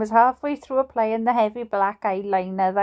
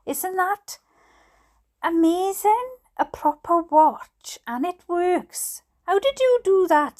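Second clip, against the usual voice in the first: amazed admiration; 7 semitones higher than usual. Acted. Dad admiring Steve's handiwork, amazed. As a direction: marvel at it, pitched up and savoured.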